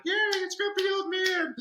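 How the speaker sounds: put-on very high, slow, loud shouted kid cheer. Teacher imitating his class cheering for grumpy old man, high slow shout. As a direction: shout it like thrilled kids. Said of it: "Kid's like".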